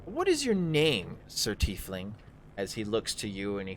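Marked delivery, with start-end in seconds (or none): 0.0-2.1 lightly voiced pleased drow, lilting higher; a hint of a smooth henchman sizing up a guest; drow servant. Drow greets the tiefling newcomer, lightly in character. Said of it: "and he's like".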